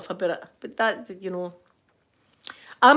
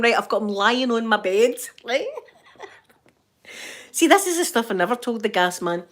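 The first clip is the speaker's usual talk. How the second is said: ordinary talk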